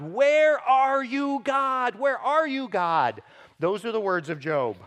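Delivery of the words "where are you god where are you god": high, loud, slow anguished cry; imitating Job's desperate cry, drawn out. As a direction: call out to an absent God in pain. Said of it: "Job cries out to God".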